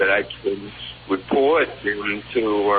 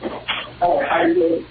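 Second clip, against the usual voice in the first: hydrophone underwater voice. Real, not acted. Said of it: "You sound like you're underwater".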